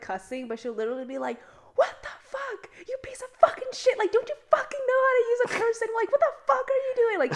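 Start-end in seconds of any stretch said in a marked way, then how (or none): 1.8-7.2 high-pitched mock furious rant; hammed-up angry driver yelling, voice pitched way up; imitated road-rage screaming. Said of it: "like, screaming in the car".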